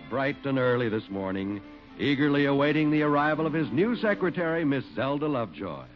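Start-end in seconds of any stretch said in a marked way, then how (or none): none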